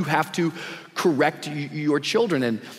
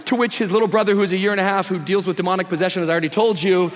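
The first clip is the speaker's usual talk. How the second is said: usual speaking voice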